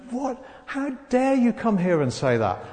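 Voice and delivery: high-pitched, affronted outburst in retelling; indignant Pharaoh. Acted. Acted Pharaoh answers Moses indignantly.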